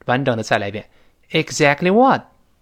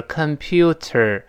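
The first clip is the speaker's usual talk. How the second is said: "computer" slowly, with a distinct t; slow careful reading; much slower than usual. Shown as a demonstration. Teacher showing the slow form, careful reading. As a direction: spell out each syllable of "computer" unhurriedly. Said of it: "读得很慢的时候是computer".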